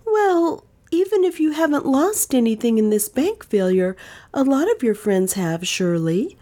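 put-on high earnest young woman. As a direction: plead as the heroine. Dale voiced by narrator.

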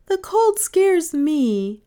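put-on high, loud, slow child's voice; little girl confiding. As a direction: confess it slowly in a high girl's voice. Little girl confides her fear of cold.

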